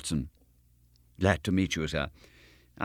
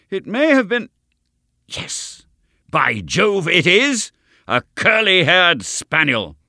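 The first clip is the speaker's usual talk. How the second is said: higher, louder, savouring each word; a detective who has just cracked it; rising to a pleased exclamation; triumphant realisation; 10 semitones higher, 6 dB louder, slower than usual. Acted. Holmes's sudden triumphant conclusion.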